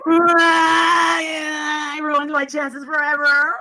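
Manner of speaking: loud, drawn-out hammed-up cry; mock wail.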